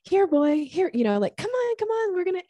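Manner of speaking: high, eager call to a dog. Shown as a demonstration. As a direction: rev up a dog like playtime. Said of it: "how you call a dog".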